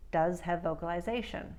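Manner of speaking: ordinary talk, nothing marked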